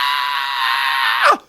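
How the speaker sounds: loud mock cry of pain; imitated pain cry. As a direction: yelp like the injured man. Imitates ammo man's cry of pain.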